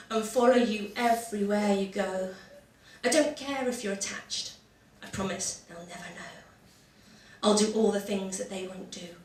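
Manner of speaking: soft, slow, mock-tender love poem; comic poem recital. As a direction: recite it like a lovesick ballad.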